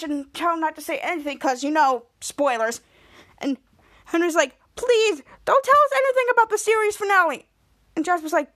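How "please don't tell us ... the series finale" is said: high, fast acted begging; imitated frantic plea; urgent pleading. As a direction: beg to be spared a spoiler. Henry begs for no spoilers.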